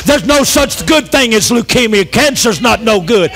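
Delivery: ordinary delivery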